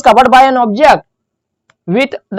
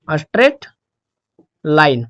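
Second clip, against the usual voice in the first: "Straight", long pause, then "line" lower; word by word; 7 semitones lower, slower than usual. Shown as a demonstration. Teacher giving students the graph's nature, word by word. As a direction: dictate "straight" and "line" as separate words. Repeats term slowly while writing.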